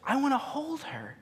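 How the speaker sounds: put-on high, eager child voice; excited little daughter. Daughter asking to hold the baby, eager. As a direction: imitate a child bursting to join in. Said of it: "their eyes just lit up".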